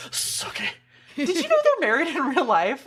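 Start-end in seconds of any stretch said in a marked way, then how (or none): 0.1-0.7 1 teasing whisper. Real, not acted. Teasing aside.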